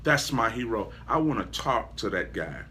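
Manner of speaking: hushed, earnest wanting to meet the hero; admiring seeker. Retold or imitated. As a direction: ask urgently but low, like a confidence. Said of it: "look at you and say".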